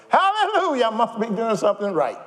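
high, loud, laughing praise; laughing hallelujah. Pretend. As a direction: laugh it off like a happy believer. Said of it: "just start laughing, rejoicing".

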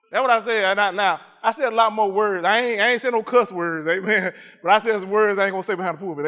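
ordinary delivery

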